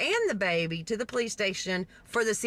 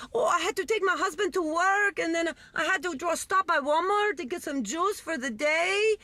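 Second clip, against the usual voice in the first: higher, singsong mock Jamaican accent; imitated Jamaican mother; 7 semitones higher than usual. Mother explains absence to police, imitated Jamaican accent. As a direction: imitate a breezy Jamaican excuse.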